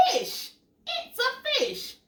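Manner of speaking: high, delighted announcement; excited reveal. Acted. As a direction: reveal it with glee.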